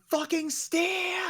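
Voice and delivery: very high, slow strained yell; mock gamer rage. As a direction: stretch it out in high-pitched pretend fury. Acting out a raging child player.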